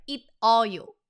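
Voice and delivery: "It all you" chopped into separate syllables, loud; choppy misreading. Shown as a demonstration. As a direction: misread "It all you" with a hard stop after "It". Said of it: "will be like".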